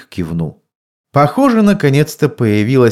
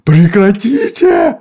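yelling; 10 semitones higher, 5 dB louder, slower than usual. A narrator's reading. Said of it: "заорал мистер Уилкс".

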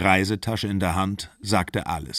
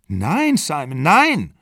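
high-pitched, emphatic refusal; acted upset denial in a quarrel; agitated denial; 7 semitones higher, 4 dB louder, slower than usual. A narrator's reading. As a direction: protest with voice leaping up.

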